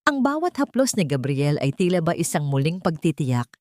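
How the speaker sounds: usual speaking voice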